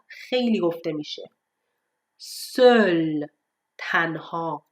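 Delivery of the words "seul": Seul with a rounded vowel between o and e; correct French eu. Shown as a demonstration. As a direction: model Seul slowly, neither sol nor soul. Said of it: "سول نیست".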